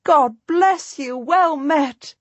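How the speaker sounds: high, slow, glad God bless you with bless lifted; queen's joyful greeting. A narrator's reading. Queen greeting Jacquetta on the march, high joyful voice. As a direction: greet her like a delighted queen. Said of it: "she cries out with genuine joy".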